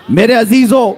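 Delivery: calling out. Real, not acted. Preacher rousing his listeners, loud high call. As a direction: call out میرے عزیزو with a falling shout.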